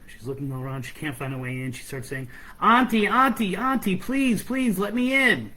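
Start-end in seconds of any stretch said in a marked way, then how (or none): 2.6-5.5 high, loud begging cry; pleading call. Acted. Girl begs her aunt to let her into cabin, pleading loudly. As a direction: call out pleadingly, much higher and louder.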